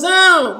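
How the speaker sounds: high, loud pleading hum; loud wanting whine without words; imitated child's hum. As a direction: hum like a kid begging for the toy. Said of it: "And he goes".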